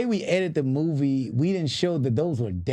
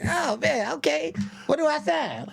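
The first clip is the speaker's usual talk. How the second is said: mock eager; 13 semitones higher than usual.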